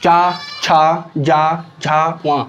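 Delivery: चा, छा drawn out slowly, one at a time; teacher voicing each syllable very slowly; slow syllable demo. Shown as a demonstration. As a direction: spell out the palatal sounds slowly for the class. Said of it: "तालव्य".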